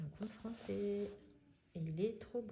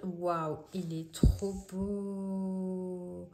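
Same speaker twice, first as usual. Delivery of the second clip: very slow, louder, drawn-out awe; gushing awe; 5 dB louder, much slower than usual. Real, not acted. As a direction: stretch it out, wowed by the picture. Gushing over a picture.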